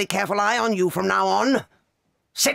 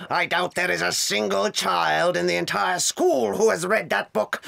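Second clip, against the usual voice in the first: ordinary talk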